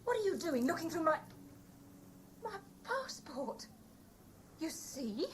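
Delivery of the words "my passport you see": slow, quiet and tearful; the voice cracks into a sob; breaking into tears. Acted. She breaks down, confronted.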